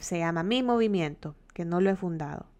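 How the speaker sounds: ordinary talk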